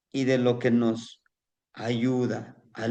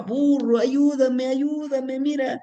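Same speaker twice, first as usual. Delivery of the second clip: high, desperate plea for help; pleading cry; 11 semitones higher, slower than usual. Acted. Pig caught by the farmers, crying out high for help. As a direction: beg for rescue, voice pitched high. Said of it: "el cerdito gritaba".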